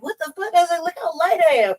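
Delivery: high-pitched, indignant mock outburst; incredulous protest. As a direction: rise into a high, disbelieving retort. Retold indignant protest at insult.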